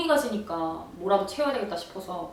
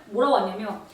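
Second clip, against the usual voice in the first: ordinary talk, nothing marked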